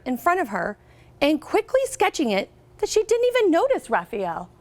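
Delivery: storybook reading voice, lively and clear; picture book read-aloud; reading to children. Acted. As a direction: read it out to a class of third graders.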